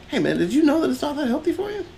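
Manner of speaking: high, fast voice with a laugh breaking through; mock ice cream man laughing. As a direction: ham up the vendor, giggling. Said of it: "he's like".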